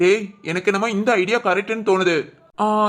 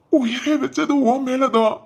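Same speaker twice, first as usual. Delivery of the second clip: slow, slightly raised, pleading voice; anguished plea; 4 semitones higher, slower than usual. Acted. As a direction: beg her to come one last time. கடைசியாக பார்க்க கெஞ்சுதல். (கெஞ்சும் துயரக் குரல்)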